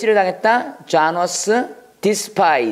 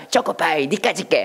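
mock-scornful put-down; acted contempt. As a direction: dismiss a rival as worthless, playfully. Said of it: "초코파이 경멸했어". (장난스럽게 경멸하는 투)

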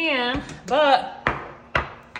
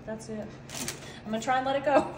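usual speaking voice

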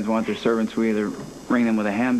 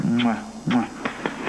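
air kisses. Acted. Playful goodbye kisses.

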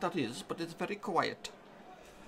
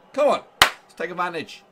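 urgent shout; 13 dB louder than usual. Real, not acted. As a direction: bellow at the players to push on. Urging team during match.

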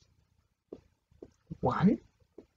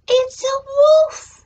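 ordinary delivery, nothing marked

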